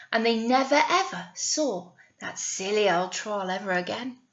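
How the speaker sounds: ordinary delivery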